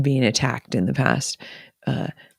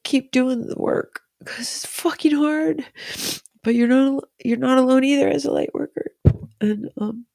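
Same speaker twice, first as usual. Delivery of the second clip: high-pitched, real tearful voice; choked-up and high, pushing on through crying; tearful blubbering; 9 semitones higher than usual. Real, not acted. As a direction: talk through tears, voice lifted high. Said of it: "blubbering on".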